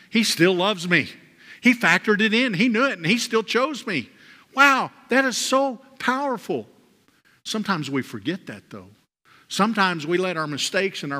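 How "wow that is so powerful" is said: amazed wow. Real, not acted. Preacher marvels at the idea.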